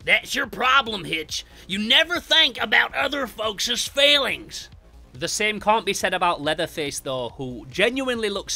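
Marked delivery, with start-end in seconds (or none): 0.0-4.7 high, loud, drawn-out cannibal banter; comic-book character voices. Acted. Cannibals bickering over serving Jason as stew, exaggerated character voices. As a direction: stretch it out, pitched up and loud. Voicing Cook's and Hitchhiker's quoted dialogue.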